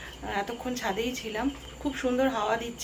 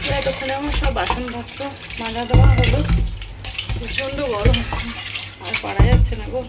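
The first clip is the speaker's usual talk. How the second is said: exasperated complaint; 8 dB louder than usual. Real, not acted. Complaining about the heat.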